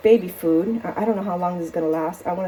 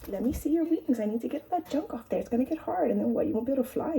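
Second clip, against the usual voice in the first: higher and softer cooing; soft pet talk; 5 semitones higher, 5 dB quieter than usual. Real, not acted. Owner softly cooing to the bird while cleaning her wings.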